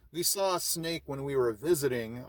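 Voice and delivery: usual speaking voice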